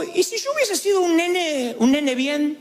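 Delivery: put-on lightly high whiny child voice; spoiled kid. Preacher imitating a spoiled kid, lightly high and whiny. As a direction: play a pampered little boy, a touch whiny. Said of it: "pero digo".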